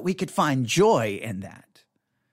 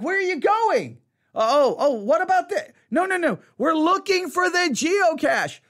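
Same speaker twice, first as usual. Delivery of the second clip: high, raised parent's call; calling after kid; 13 semitones higher, 4 dB louder than usual. Retold or imitated. Parent calling a wandering kid back, raised and high.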